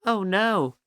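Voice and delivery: a sudden high groan of dismay; mock dismay. [name] realising he went first, high mock dismay. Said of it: "[name] said".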